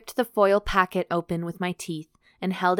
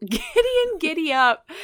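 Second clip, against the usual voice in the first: high, laughing and loud; 10 semitones higher, 4 dB louder than usual. Real, not acted. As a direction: giggle the words through. Mocking the name, laughing.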